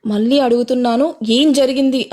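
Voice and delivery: ordinary delivery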